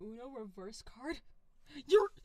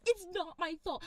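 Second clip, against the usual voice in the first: usual speaking voice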